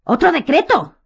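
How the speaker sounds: loud, high outraged cry; violent exclamation. A narrator's reading. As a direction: explode in outrage. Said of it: "exclamó ... con violencia".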